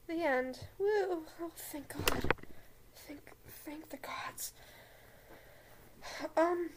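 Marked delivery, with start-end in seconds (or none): 0.8-1.3 high loud whoop; relieved cheer. Real, not acted. Podcaster finishes the fanfic, high loud whoop. 1.4-4.5 slow, soft whispered thanks; relieved whisper. Real, not acted. Podcaster relieved the reading is done, slow soft whisper.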